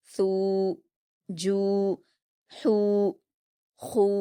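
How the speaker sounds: each syllable held long with pauses between; teacher drills the takhti row slowly; drilled long u syllables. Shown as a demonstration. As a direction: chant each letter with a stretched u. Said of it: "दो हरकात खींचेंगे".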